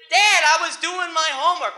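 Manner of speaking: loud, high-pitched defensive cry; hammed-up indignant child; protesting kid. Child defending himself to stubborn dad, loud mock protest.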